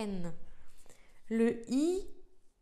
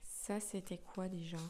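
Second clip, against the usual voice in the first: whisper; 3 semitones lower, 8 dB quieter than usual. Real, not acted. Cherche la lettre, triche un peu.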